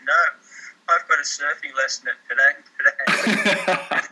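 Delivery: put-on Scandinavian accent. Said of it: "Scandinavian accent".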